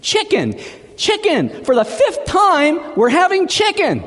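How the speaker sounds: high-pitched mock fury of a fed-up wife; enraged scream. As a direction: shriek it in hammed-up exasperation. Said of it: "turns to him in a rage and screams".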